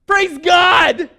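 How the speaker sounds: real joy exploding from the pulpit; joyful shout. Real, not acted. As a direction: burst out shouting praise. Joyful outburst in sermon.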